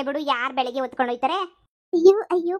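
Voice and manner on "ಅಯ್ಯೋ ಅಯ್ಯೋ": higher, louder scornful cry; mock exclamation. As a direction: exclaim at her foolishness. Mother-in-law mocks foolish daughter-in-law. (ಜೋರಾದ ವ್ಯಂಗ್ಯದ ಉದ್ಗಾರ)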